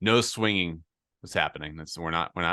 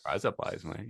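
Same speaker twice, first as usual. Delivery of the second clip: softer Australian-accented "rise up lights, mate"; 8 dB quieter than usual. Shown as a demonstration. Showing his friend the trick, soft Australian accent. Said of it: "teach somebody an Australian accent".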